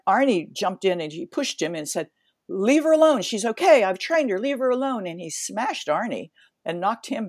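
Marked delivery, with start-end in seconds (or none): 2.5-5.1 raised, higher voice telling him to back off; retold shout. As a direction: shove him off with your voice. Coach Arnie pushes attacker away.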